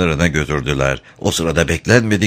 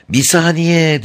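shouting; 10 semitones higher, slower than usual. A narrator's reading.

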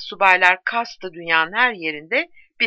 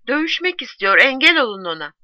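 raised, louder insistent appeal; urgent plea; 6 semitones higher, 5 dB louder than usual. A narrator's reading. Postwoman warns the friends he wants to fight, urgent plea. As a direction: press them to intervene.

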